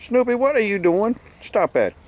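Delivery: high, teasing voice to his dog; high pet-talk question. Real, not acted. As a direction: ask the dog like scolding a pup. Talking to his dog Snoopy.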